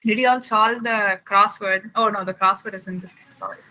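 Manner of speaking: ordinary talk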